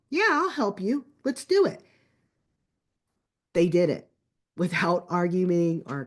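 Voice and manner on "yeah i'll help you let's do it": high, upbeat retold agreement; eager kid. Son offering his brother math help, eagerly. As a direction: voice a keen brother jumping in to help.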